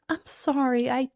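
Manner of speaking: high, faltering apology; anguished, sorry stammer; remorseful. Acted. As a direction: plead for forgiveness. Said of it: "all remorse".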